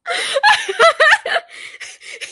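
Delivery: breaking into high giggles; can't stop laughing; high burst of laughter. Real, not acted. Cracking up at the table.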